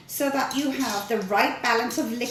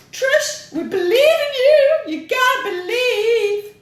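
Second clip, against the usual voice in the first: much higher, loud cheering quote; fans cheering; 11 semitones higher, 7 dB louder than usual. Retold or imitated. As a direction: belt out the fans' support with a catch in the voice. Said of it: "I'm getting very emotional".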